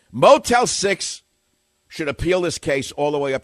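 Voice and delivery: sudden indignant shout of the name; loud outburst. Real, not acted. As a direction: blast the company name out. Host bursts out angrily.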